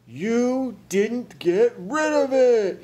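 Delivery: high, slow outraged shout. A narrator's reading. Frizzle discovers the baby is still there, shouting. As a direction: shout each word, loud and drawn out. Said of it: "he shouted".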